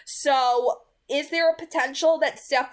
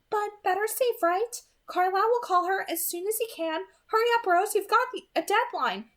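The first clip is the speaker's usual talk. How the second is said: Alice urging Rose; 9 semitones higher than usual. Acted.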